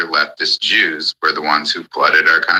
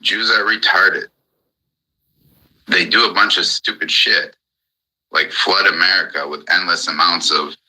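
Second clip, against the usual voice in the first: ordinary delivery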